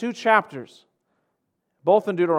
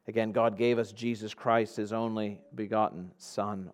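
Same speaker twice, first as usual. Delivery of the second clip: usual speaking voice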